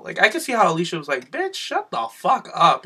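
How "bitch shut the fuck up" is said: high, slow indignant clapback; mock indignation, pitched up and drawn out; acted sassy comeback. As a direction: draw out the telling-off in a higher pitch. Acting out Alicia's angry comeback.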